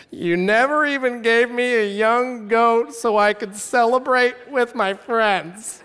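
slow, high, drawn-out whine; milk the self-pity, stretching the last words; pathetic whining. Acted. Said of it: "this is the most pathetic verse".

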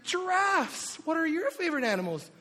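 put-on high, excited parent-to-toddler voice; doting baby talk. Dad answering his toddler's question, high delighted baby talk.

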